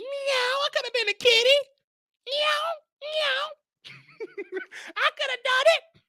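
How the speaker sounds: high falsetto purring kitty with meows. Pretend. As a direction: coo like a flirty cat, very high.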